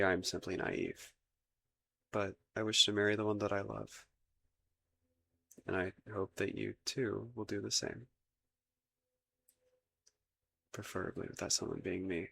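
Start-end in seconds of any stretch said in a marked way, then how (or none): 5.7-12.3 soft, tentative hope; shy confession. Acted. Prince hoping the beloved will choose him, shy and soft.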